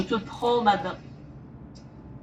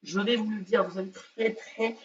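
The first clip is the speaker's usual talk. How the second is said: fading hush; 3 semitones lower, 12 dB quieter than usual. Acted. Teasing build-up, fades to hush.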